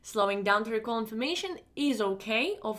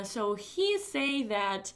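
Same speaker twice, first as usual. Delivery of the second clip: demo mistake; much slower than usual. Shown as a demonstration. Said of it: "For example, you say".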